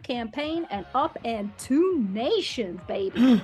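put-on Texan accent. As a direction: lean into a sweet-talking Texan accent. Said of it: "drop an octave and add a baby".

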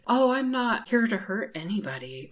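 mock sweet, innocent tone; imitating her fake voice. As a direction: mimic a fake-innocent scammer. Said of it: "that's how she was talking".

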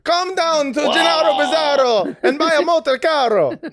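high, pushy Italian car salesman; cheesy car ad voice. Pretend. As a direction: sell it like a cheesy TV ad. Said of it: "a musical name".

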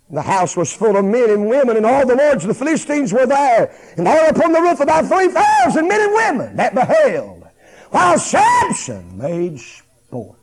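high, very loud preacher's shout; full-force preaching. Real, not acted. Preacher reading the verse to the congregation, shouting at full force. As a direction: thunder the scripture out to the congregation.

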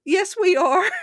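high, loud, laughing through the words; laughing insistence. Retold or imitated. Beth laughing as she retells arguing with Daddy. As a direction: insist while cracking up. Retold argument with father, laughing.

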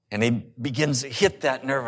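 ordinary talk

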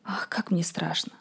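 slow, tearful whisper; frightened whisper. Acted. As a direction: whisper it with fear and tears. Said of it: "с глазами полными слёз сказала".

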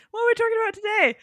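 squeaky, quick host question; high silly voice. Acted. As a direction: pitch it way up like a cartoon. Repeated in a high put-on voice.

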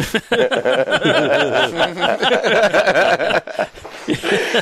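long high-pitched laughing fit; helpless run of high laughter. Real, not acted. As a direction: burst out laughing at length. Laughing at his own story.

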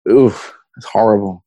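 normal talking voice

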